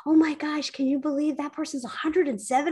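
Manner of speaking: high, fast gush of amazement; amazed kid reaction. Retold or imitated. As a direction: blurt it out in wide-eyed disbelief, laughter creeping in. Acting out the children's amazed reaction.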